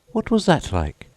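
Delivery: put-on very high girlish question; Alice asking. A narrator's reading. Alice asking the Mock Turtle, very high and curious. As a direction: pitch far up, curious girl. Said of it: "said Alice".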